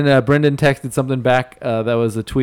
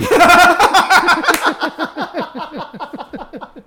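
long high wheezing laugh; laughing helplessly; long loud laugh; 4 dB louder than usual. Real, not acted. Laughing at Brett's text.

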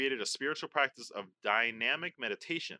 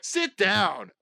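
high, loud mock scolding; playing bad cop; 6 semitones higher, 5 dB louder than usual. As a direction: pretend to lay down the law. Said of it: "I like being bad cop".

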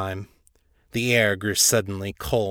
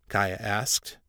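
ordinary delivery

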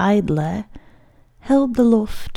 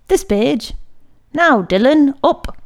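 raised, louder voice of a teacher calling on a pupil; stern schoolmaster; 6 semitones higher, 5 dB louder than usual. A narrator's reading. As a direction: order the boy up before the class. Priest calls on pupil in class.

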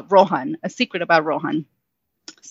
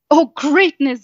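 higher, louder joyful bellow; boy cheering at the silent wall, louder and higher; 7 semitones higher, 4 dB louder, slower than usual. Acted. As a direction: call it out in delight. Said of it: "Zarantha bellowed".

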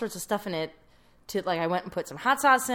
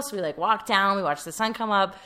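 ordinary delivery

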